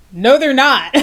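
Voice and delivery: breaks into a real laugh. Real, not acted. Laughing at Beachbody's naming.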